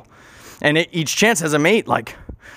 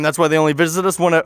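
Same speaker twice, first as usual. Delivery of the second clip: usual speaking voice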